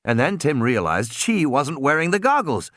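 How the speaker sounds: usual speaking voice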